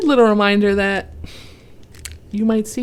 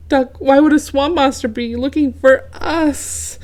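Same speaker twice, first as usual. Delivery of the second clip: higher, louder worried voice; anxious goose pleading with his friend; nervous goose asking Duck; 7 semitones higher, 6 dB louder than usual. A narrator's reading. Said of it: "Goose asks Duck".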